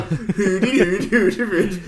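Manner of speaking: loud, drawn-out nonsense syllables in mock German; mock German gibberish. Podcaster joking about an actress faking German, mock German gibberish. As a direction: hoot out slow fake-German gibberish, half laughing. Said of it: "not just going around being like".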